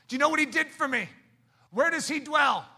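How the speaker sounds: loud, high preacher's questions; preaching at full force. Acted. Preacher calling out to the congregation about where Jesus is. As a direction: call it out to the room.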